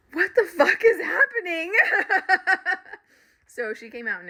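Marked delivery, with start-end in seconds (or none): 0.1-1.7 shocked outburst. Retold or imitated. Voice suddenly speaks through car. 1.8-2.9 burst of laughter. Real, not acted. Laughing at own story.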